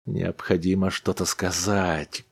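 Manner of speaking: ordinary delivery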